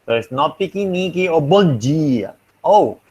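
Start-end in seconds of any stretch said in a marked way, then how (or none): 1.4-2.3 "bon dia" with a J sound, bon JEE-a; palatalized D. Shown as a demonstration. Teacher citing a Portuguese example, "dia" palatalized to jee-a. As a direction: say the d in "dia" like English J. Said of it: "forces the T to become palatal".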